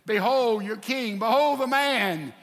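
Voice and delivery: loud announcement, rising and slowing; acted proclamation. Pilate presents beaten Jesus to the crowd, loud proclamation.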